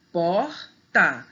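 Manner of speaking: POR, TÁ as two separate beats; syllable by syllable. Shown as a demonstration. Teacher splitting porta, saying POR and TÁ separately. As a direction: break porta into por, pause, tá. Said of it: "Separar a sílaba direitinho".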